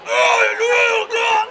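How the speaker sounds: high, loud pained scream. Retold or imitated. Brother rolled off the roof, retold pained scream. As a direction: yell out as if hurt from the fall. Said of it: "starts going".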